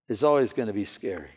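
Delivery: usual speaking voice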